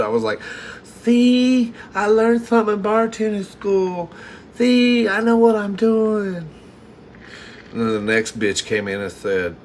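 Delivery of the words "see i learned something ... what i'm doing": high, loud, giddy bragging; proud excitement. Retold or imitated. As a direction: crow about finally proving yourself. Bragging to himself after praise.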